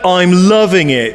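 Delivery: ordinary delivery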